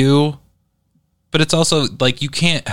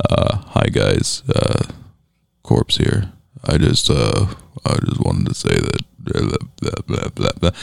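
Corpse voice; 14 semitones lower than usual. Retold or imitated. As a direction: drop to a deep, low rumble. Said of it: "I would like to do a corpse impression".

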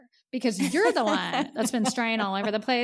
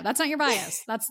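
ordinary talk, nothing marked

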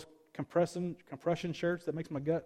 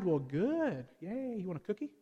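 sarcastic mock cheer, softer; snarky; 4 dB quieter than usual. Real, not acted. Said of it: "I can be a little snarky".

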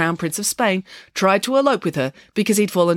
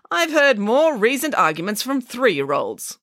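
slow, higher-pitched put-down; unimpressed nanny; scornful; 7 semitones higher, slower than usual. Acted. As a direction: draw it out with disdain. Nanny scorns the director's argument.

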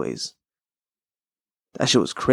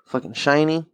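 ordinary delivery

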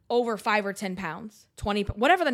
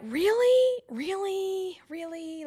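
drawn-out, high-pitched "really?"; incredulous; 9 semitones higher, slower than usual. Real, not acted. Podcaster reacting to sudden weight gain, incredulous.

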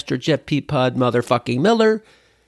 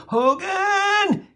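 very high barked Hogan; Colonel Klink yell. Retold or imitated. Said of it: "Colonel Klink reprimanding Hogan".

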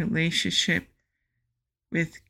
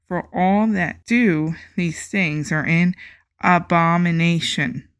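loud, solemn Bible reading; scripture reading; 4 dB louder than usual. Acted. Podcaster reading scripture to listeners, solemn and loud. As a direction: proclaim the verses like a preacher.

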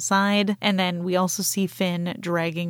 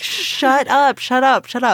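loud, higher acted outburst; exasperated shout; 6 semitones higher, 7 dB louder than usual. Podcaster as Poe silencing C-3PO, exasperated shout. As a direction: shout down a chattering droid.

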